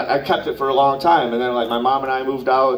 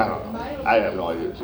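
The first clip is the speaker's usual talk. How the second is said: mumbled; 7 semitones lower, 5 dB quieter than usual. Real, not acted. Mumbled aside.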